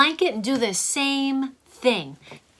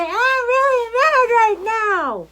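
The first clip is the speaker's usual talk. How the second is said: much higher, louder, slow drawn-out yell; scream pillow yell; 12 semitones higher, 7 dB louder, slower than usual. Shown as a demonstration. Teacher showing kids the scream pillow, slow loud yell. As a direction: shout it into the pillow. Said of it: "go to your scream pillow and say".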